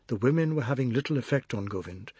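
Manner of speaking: plain reading voice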